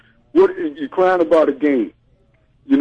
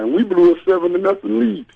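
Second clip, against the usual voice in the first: ordinary talk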